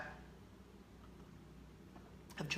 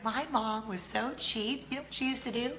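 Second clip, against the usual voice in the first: children mimicking mom in a hammed-up Southern accent, building louder and higher; 5 semitones higher, 4 dB louder than usual. Kids telling on their cheap mom, mock Southern accent. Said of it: "my children don't speak in a Southern accent".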